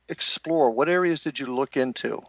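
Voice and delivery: ordinary delivery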